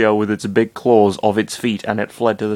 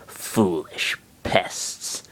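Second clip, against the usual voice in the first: slow whispered sneer; muttered villain; 3 semitones lower, 6 dB quieter, much slower than usual. Acted. Villain dismissing the heroes, slow whisper. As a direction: hiss it out slowly, contemptuous. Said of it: "the man muttered with a chuckle".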